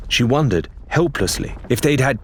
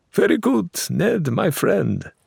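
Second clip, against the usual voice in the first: happily; 10 semitones higher than usual. Acted. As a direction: praise him like a delighted old friend. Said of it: "Faisal said happily".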